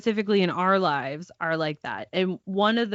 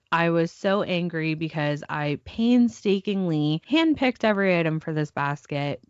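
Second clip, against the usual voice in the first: ordinary talk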